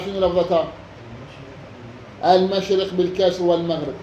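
ordinary delivery

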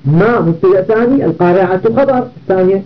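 usual speaking voice

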